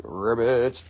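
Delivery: deep-pitched ribbit; playful low croak. Pretend. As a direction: drop the name down low. Playing with a buyer's name.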